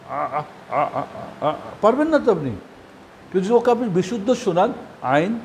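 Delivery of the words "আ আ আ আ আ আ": repeated strained ah from the throat; straining throat sound. Shown as a demonstration. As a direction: struggle to make the throat letter. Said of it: "এখন বলতে তো পার কষ্ট হবে". (গলা থেকে আ আ করে কষ্টের শব্দ)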